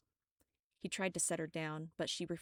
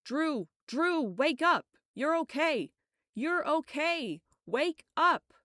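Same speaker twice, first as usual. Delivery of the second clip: loud high frantic calls; shouting her awake in panic; 10 semitones higher, 10 dB louder, slower than usual. Acted. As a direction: yell to bring her back.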